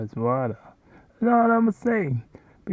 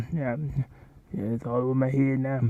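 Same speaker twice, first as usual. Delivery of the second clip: ordinary talk, nothing marked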